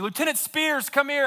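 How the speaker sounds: mock shout to a distant officer; calling out. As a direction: call him over from a distance. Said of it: "he shouts over to another company".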